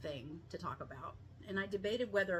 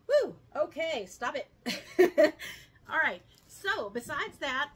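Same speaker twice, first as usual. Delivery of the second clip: laughing; 7 semitones higher, 11 dB louder than usual. Real, not acted. Laughs at herself, shaking it off.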